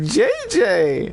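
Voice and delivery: high, mock-excited greeting; excited name call. Retold friend excitedly calling name.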